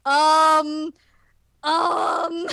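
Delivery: loud, high panicky hesitation; mock bewildered heroine; flustered um. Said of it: "She's just like".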